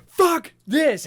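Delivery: high, loud fed-up yell; panicked yell. Retold or imitated. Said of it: "I'm just like".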